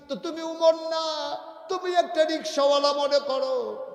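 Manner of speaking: high, loud preaching cry; impassioned preacher's shout; full-force exhortation. Real, not acted. Preacher exhorts his listener.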